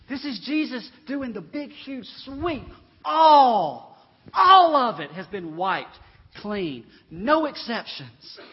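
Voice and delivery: high pulpit cry with huge stretched ALL; full-force preaching. Acted. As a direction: proclaim it in long, swelling key words.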